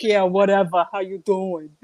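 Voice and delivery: loud, careless American greeting; breezy loud New York hello; casual American. Retold or imitated. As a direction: toss it off like a New Yorker. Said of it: "Here we're like".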